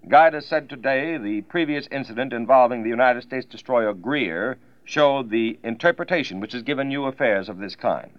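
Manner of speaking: 1940s radio news read; crisp wartime bulletin delivery; old newsreel. Acted.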